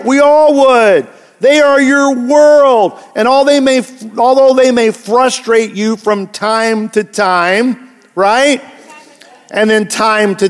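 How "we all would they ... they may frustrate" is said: slow, louder and higher, full conviction; impassioned. Real, not acted. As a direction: drive each word home with passion. Preacher building passion.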